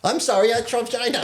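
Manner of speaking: put-on very high, silly Chinese-accent apology; mock Chinese accent. Imitating a mate excusing bad driving, mock Chinese accent. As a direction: squeal out a fake Chinese accent. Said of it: "but he makes that stupid".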